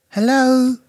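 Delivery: nervous, high, wavering hello; quivering voice. A narrator's reading. Luke calls into the dark apartment, voice quivering. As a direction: call out timidly with a quiver. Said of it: "his voice quivering".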